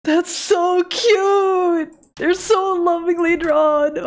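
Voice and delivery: high, slow, teary gushing; real tearful joy at a gift; happy crying. Real, not acted. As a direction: sob out the praise, overwhelmed. Gushing over fan art.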